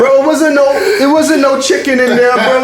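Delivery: high, laughing disbelief; incredulous laughing rant. Real, not acted. Incredulous rant about veggie stew.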